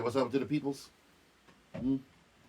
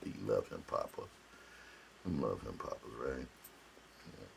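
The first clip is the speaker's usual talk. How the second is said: low, soft murmur to a pet; tender doting; 8 semitones lower, 10 dB quieter than usual. Real, not acted. Owner fussing over his beloved dog, low and tender. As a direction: talk softly and lovingly, much lower.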